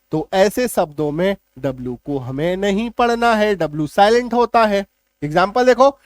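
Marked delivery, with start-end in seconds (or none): none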